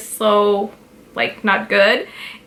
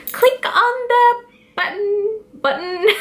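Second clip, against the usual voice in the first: much higher, sing-song YouTuber outro voice; mock YouTuber voice; 11 semitones higher than usual. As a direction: ham up a bubbly YouTuber sign-off. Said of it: "what do, like, these YouTubers do?".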